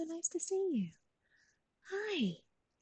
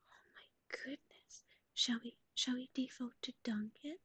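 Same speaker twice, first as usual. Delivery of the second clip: fading unvoiced whisper, getting softer; 5 dB quieter than usual. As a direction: hush it down to almost nothing. Whispers asking chat about snack.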